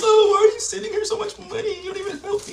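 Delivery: a mock sobbing girlfriend, voice pushed very high; high mock crying woman. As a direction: mimic her crying in a high squeaky voice. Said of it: "Talking about".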